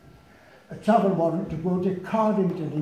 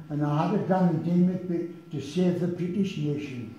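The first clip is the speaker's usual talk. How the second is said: a lad from Wallsend reading his own verse in Geordie; Geordie accent poem. Retold or imitated. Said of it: "I'll try and give it to you in his accent".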